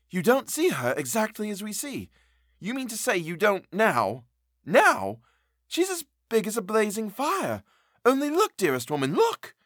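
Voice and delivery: high, urgent, insistent pleading. A narrator's reading. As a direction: plead desperately. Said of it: "narrator voicing governess pleading".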